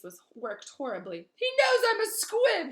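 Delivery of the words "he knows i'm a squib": very high, loud, slow anguished cry; anguished outburst. Acted. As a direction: force out the shameful word. Filch's anguished confession.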